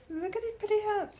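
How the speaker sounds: high cooing voice over pretty. Real, not acted. Admiring the squirrel's hat in a high cooing voice. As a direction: coo it like talking to a pet.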